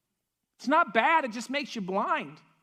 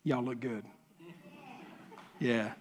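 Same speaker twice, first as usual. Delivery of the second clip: a light, low [name] voice, a bit softer; [name] impression; 12 semitones lower, 9 dB quieter than usual. Pastor in red glasses greets the congregation as [name], lightly voiced. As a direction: play the rock star greeting the crowd, lightly. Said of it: "my best [name] impersonation".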